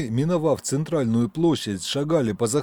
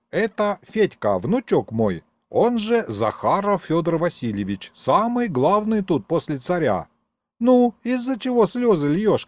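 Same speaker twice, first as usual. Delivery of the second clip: lightly voiced old man, lilting high; old grandpa introducing his grandson; 6 semitones higher than usual. Grandpa introduces his grandson to the crying couple, lightly acted old man. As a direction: present your grandson with a touch of show.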